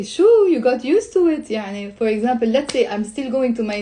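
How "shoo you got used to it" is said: higher-pitched, mildly teasing question; lightly acted teasing; joking tease. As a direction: rib a friend with mock surprise. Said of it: "people use it as a joke".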